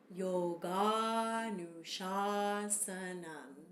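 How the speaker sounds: Yoganushasanam drawn out very slowly, open ah sounds; slow demo. Shown as a demonstration. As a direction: spell Yoganushasanam out slowly with a dropped jaw on each ah. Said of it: "Let's try that together two times".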